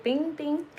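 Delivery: high bing bing noise; playful sound effect. Pretend. Explaining ghosts stopping, a high playful bing. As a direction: chirp it like a bell.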